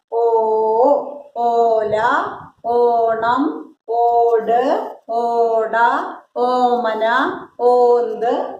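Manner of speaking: slow list, every initial ഓ stretched; drawn-out long vowel. Shown as a demonstration. Teacher giving pupils example words, each ഓ drawn out. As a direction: hold the opening ഓ of each word long. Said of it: "ഓ നീട്ടി പറയാൻ".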